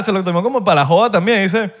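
ordinary talk

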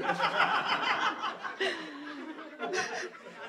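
high breathless giggling fit; helpless giggle. Real, not acted. Reader giggling at the naughty scene.

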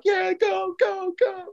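cheering on. Retold or imitated. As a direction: egg the driver on like a wild passenger.

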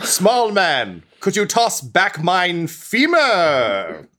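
high, slow, theatrical request; high slow skeleton voice. Acted. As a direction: ask grandly in a high voice. Skeleton asks for his bone.

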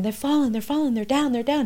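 reliving a panicked scream down the phone. Retold or imitated. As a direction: cry it out in disbelief. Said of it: "I'm screaming".

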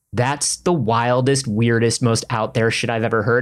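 low-pitched disbelieving quote; skeptical dismissal. Retold or imitated. Voicing a skeptical film-only viewer.

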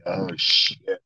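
whispered "oh shit" under the breath; mock-scared whisper to himself; whispered dread. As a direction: breathe it out like you just got caught. His own dread reaction retold.

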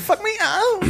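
put-on very high falsetto girl voice. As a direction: squeak it out girlishly. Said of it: "it's hard to do it".

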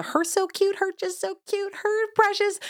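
imitating high baby talk at a cute baby; mocking baby talk. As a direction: coo it like a stranger fussing over a baby. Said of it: "it's not just the".

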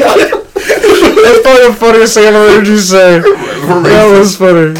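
talking through laughter, pitched high; real laughter. Real, not acted. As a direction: crack up while saying it. Cracking up at friend's joke.

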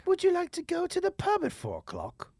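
high-pitched English accent, lifting at the end; English accent rising high at the end. Shown as a demonstration. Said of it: "My English accent sounds like a dude".

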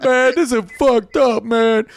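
a hurt guy losing it; distressed injured man. Retold or imitated.

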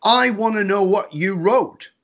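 high, slow, insistent retold demand; frustrated demand. Husband demanding to see wife's card, frustrated. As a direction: spell it out in exasperation. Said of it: "Now I'm getting frustrated".

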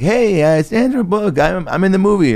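high-pitched, anxious introduction of yourself; nervous greeting. Retold or imitated. Actor greeting a woman with a barking dog, nervous and high. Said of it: "trying to nervously be like".

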